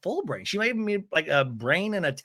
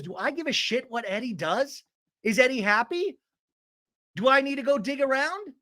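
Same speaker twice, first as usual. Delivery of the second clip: high-pitched mock indignation; indignant questioning; 8 semitones higher than usual. Jen throws questions back at Tamara, high indignant voice. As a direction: fire off rhetorical questions in a raised voice.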